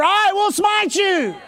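slow, drawn-out shout; bold declaration. Shown as a demonstration. Preacher shows the church how to answer cancer, slow shout. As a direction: shout it slowly at the sickness like a warrior. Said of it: "You need to say to cancer".

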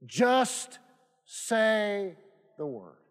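"Just", "say", "the word" set apart by pauses; slow, emphatic. Shown as a demonstration. Preacher showing what great faith looks like, slow and emphatic. Preacher repeating the key verse.

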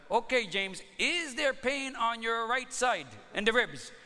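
stately phony physician greeting his patient; put-on doctor voice. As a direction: play a smooth prank-call doctor with a stage accent. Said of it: "this is Doctor Tang".

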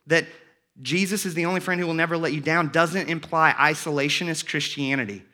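usual speaking voice

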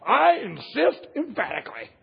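loud, high, hammed-up insistence; emphatic. Peter vowing loyalty to Jesus, hammed-up and emphatic. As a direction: declare it at full force as a mock boast. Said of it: "How do you say anything more strong".

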